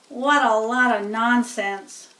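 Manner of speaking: ordinary delivery, nothing marked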